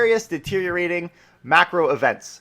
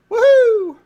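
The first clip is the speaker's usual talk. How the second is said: high, loud whoop of delight; excited cheer; 9 dB louder than usual. Real, not acted. Podcaster cheering that drive-in theaters can reopen, high excited whoop. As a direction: let out a big high woohoo.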